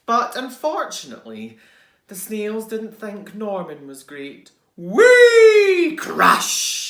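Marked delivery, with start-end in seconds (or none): 4.8-5.9 high loud sliding whee; shrieking wheee of fun; gleeful whee. Acted. Sound effect in story. 6.0-6.8 loud crash. Acted. Sound effect.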